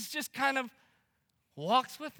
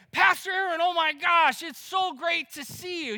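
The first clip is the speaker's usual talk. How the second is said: higher, excited fan greeting; gushing greeting; 6 semitones higher, 4 dB louder than usual. Retold or imitated. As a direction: gush over the pastor like a fan. Retold stranger's gushing greeting.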